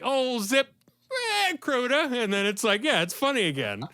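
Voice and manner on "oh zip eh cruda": high, hammed-up Boston comic; Boston accent. Fan imitating a comic's off-the-wall riff, Boston accent. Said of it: "then he'll be like".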